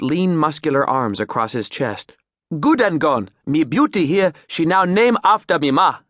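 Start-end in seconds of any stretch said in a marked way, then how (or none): 2.6-6.0 loud, pleased Creole captain; Creole accent. Acted. Captain proud of his boat, Creole accent. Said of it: "He grinned".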